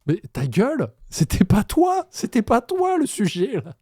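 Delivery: shouted high and loud at the screen; angry outburst. Real, not acted. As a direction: yell him down, fed up. Crie sur le candidat à l'écran. (crié, très aigu et fort, excédé)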